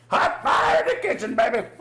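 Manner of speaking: very high, shouted catchphrase; over-the-top high-pitched cheer; hammed-up whoop. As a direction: shout it out in a high playful holler. Hammed-up catchphrase after a woo.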